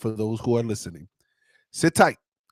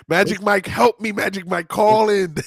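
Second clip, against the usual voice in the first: higher, louder playful plea; mock call for help; 8 semitones higher, 6 dB louder than usual. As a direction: call out for rescue, hamming it up. Mock plea to absent helper.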